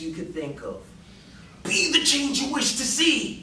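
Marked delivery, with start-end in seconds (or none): 1.6-3.4 high, hammed-up hype speaker; pumped-up preacher energy; hyped-up motivational quote. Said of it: "I was in there intense, passionate".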